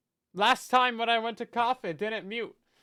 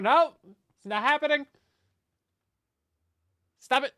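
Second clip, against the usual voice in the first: loud, higher mock protest; playful shout; 7 semitones higher, 6 dB louder than usual. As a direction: protest loudly in jest. Mock protest at more subs.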